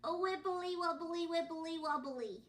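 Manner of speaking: put-on high, loud babbling baby voice. The baby chimes in at the family table, babbling high. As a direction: babble it like a happy toddler. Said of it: "went the baby".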